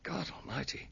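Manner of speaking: breathy aside under the breath; disbelieving whisper. Retold or imitated. Recalling thinking of the tall stranger at the door, whispered. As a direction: whisper it as a private shocked thought.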